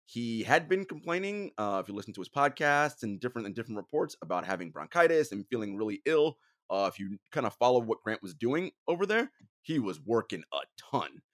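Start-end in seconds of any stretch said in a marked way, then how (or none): none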